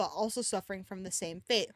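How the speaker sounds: ordinary talk, nothing marked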